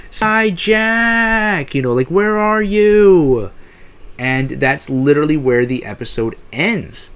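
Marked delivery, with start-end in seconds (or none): 0.1-1.7 high, far-carrying yell for Jack; yelling his name. Retold or imitated. She yells for Jack as the episode ends, high desperate call. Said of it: "ends with her yelling". 2.1-3.5 slow, high, drawn-out call; yelling out. Retold or imitated. She calls out searching for missing Jack, slow high yell. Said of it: "ends with her yelling".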